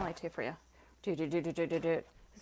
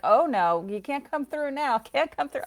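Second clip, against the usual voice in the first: mock scolding; 7 semitones higher, 6 dB louder than usual. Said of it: "you're like".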